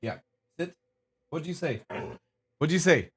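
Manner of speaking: usual speaking voice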